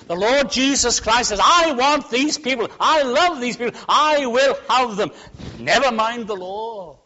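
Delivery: high, loud, emphatic pulpit delivery; full-force preaching. Acted. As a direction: drive it home to the congregation.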